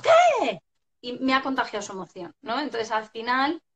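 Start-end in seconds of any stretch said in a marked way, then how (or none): 0.0-0.6 high, loud yelled-back reply; exasperated shouted retort. Retold or imitated. Mother answering her shouting son, exasperated high yell. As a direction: snap back loudly at a shrieking child. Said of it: "me lo dice chillando, yo enseguida contesto".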